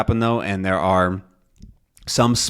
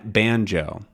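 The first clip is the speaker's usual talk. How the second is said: ordinary talk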